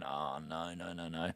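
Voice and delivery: low, soft, sinking string of "no"; quiet dread. Retold or imitated. A low dread as he realises the cop keeps licenses. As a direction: realise the trouble and sink.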